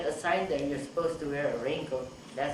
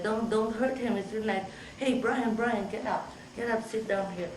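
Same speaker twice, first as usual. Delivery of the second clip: high-pitched, insistent protective plea; urgent appeal; 7 semitones higher than usual. Retold or imitated. Psychiatrist to police and Brian, high urgent appeal. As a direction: step in and coax him up. Intervening as police jump patient.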